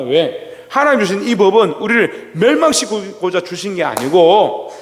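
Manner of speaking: usual speaking voice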